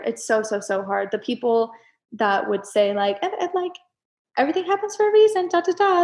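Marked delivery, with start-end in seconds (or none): 3.2-6.0 mocking. Mocking platitude people.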